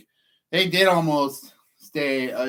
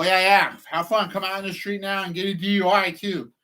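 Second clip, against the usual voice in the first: higher, mocking taunt; 6 semitones higher than usual. Man taunting construction workers, higher mocking jeer. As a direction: needle them with a singsong jeer. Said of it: "this little bitch part of me set in".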